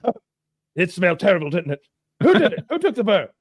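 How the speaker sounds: mock blustering Englishman; Englishman discovering loss. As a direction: notice it, then demand the culprit. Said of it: "And he's like".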